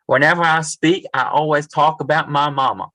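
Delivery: rough attempt at a thick Southern accent. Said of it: "in this sort of thick Southern accent".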